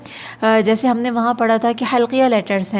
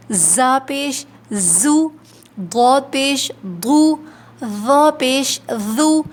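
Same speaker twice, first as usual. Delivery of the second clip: slow paired recitation, each letter set against its twin; contrast drill; much slower than usual. Shown as a demonstration. Teacher drilling similar letters for students, slow contrastive recitation. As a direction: stress each contrast clearly.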